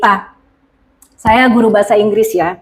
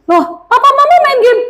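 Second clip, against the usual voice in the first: put-on child retort. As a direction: protest like a kid.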